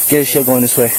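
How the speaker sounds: ordinary talk, nothing marked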